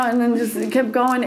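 ordinary talk

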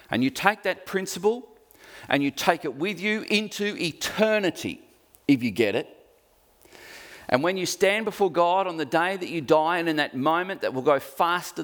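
impassioned sermon build; rising exhortation. Acted. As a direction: lift the congregation. Preacher lifts to exhortation.

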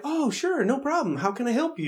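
high, overly helpful chirp; fake-nice eager. IT tech to coworker, fake-nice eager. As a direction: sound eager to please.

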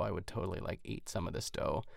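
hushed confession. Real, not acted. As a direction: drop to a hushed, almost whispered confession. Confessing craving for dough.

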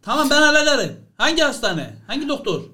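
high, confident I'll-handle-it; brisk take-charge promise; cool fixer. Acted. Mümtaz takes charge.

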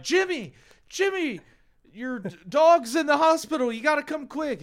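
high-pitched mock panic; frantic high-pitched heckler; urgent calling out. Heckler calls the performer urgently.

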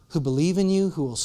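ordinary talk, nothing marked

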